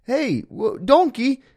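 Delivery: high, loud Canadian Shrek impression; mock Canadian accent, squeaky and loud. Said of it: "Give me your best Canadian Shrek".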